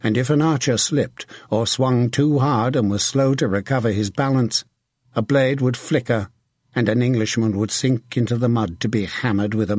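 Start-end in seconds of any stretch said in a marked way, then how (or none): none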